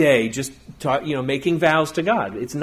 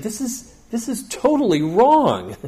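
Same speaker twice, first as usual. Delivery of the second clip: higher, slow indignant rebuke; Jesus rebuking; 8 semitones higher, 3 dB louder, slower than usual. Retold or imitated. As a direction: scold them slowly, like Jesus lambasting hypocrites. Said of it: "So Jesus just lambasted the".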